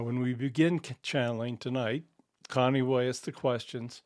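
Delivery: ordinary talk, nothing marked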